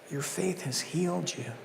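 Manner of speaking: quiet tender take; hushed blessing. Acted. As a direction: let it fall quietly. Earlier soft take.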